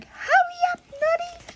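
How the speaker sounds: very high, squeaky mouse call; little mouse voice. Acted. Little mouse urging Noddy on, high squeaky call. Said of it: "the little mouse going".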